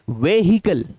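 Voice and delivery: व्हीकल said "ve-hi-kal" with sounded H; wrong pronunciation. Shown as a demonstration. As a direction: give व्हीकल three syllables with an audible H. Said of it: "गलत है".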